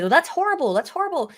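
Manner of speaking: high-pitched imitation of appalled objectors; mock horror. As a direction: act scandalized on someone else's behalf. Mimicking appalled objectors to guillotines.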